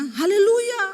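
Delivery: loud, high mock-quarrel "Haleluya"; pura-pura ribut. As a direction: shout praise as if picking a fight. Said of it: "Pasti kita kalau ribut ngomongnya". (teriakan ribut pura-pura, tinggi)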